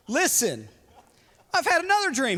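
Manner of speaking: bursting to share the dream; eager Joseph. Retold or imitated. Joseph tells brothers his dream, excited. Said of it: "he said".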